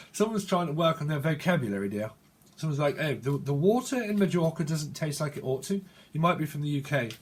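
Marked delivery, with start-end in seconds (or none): 3.0-5.8 put-on careful proper English accent; mock-proper English enunciation; speaking proper. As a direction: sound every t in proper English. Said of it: "Someone speaks proper".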